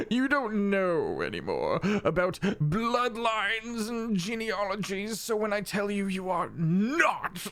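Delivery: high, slow, drawn-out condescension; haughty fey mentor. Acted. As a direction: lecture them grandly in a high, dragged-out voice. Fey mentor tells player off.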